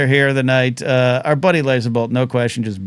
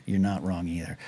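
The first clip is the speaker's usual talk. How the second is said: much softer, lower muttered repeat; quiet aside; 8 semitones lower, 10 dB quieter than usual. Real, not acted.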